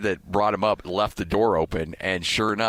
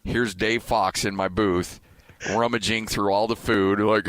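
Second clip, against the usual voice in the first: normal talking voice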